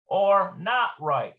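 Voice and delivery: normal talking voice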